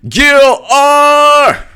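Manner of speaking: high, loud drawn-out name call; whooping greeting; excited shout-out. Real, not acted. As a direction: holler the name in delight. Calls out a viewer's name.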